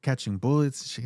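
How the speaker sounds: usual speaking voice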